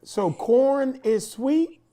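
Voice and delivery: slow, high, puzzled question; drawn-out, doubting question; surprised. Real, not acted. Cohost questions the doctor's claim about corn, slow and puzzled.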